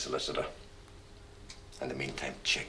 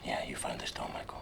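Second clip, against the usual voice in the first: low hushed whisper; 4 semitones lower, 6 dB quieter than usual. Acted. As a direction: coax him in a whisper. Quiet interview with suspect.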